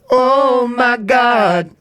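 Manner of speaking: high, loud, long-held exclamation; theatrical chorus of shock, almost sung; mock shock in unison. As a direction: hold each word in hammed-up horror. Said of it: "would have done that in unison".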